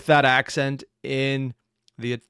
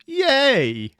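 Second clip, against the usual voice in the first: mock cheer; 12 semitones higher, 7 dB louder than usual. Sarcastic cheer at complexity.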